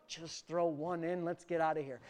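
low, soft imitated aside to buddies; conspiratorial aside. Peter privately tells his buddies to be done, conspiratorial aside. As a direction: drop low and quiet, as if only buddies should hear. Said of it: "what he's saying to his bu-, buddies".

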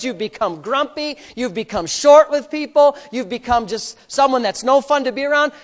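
ordinary delivery

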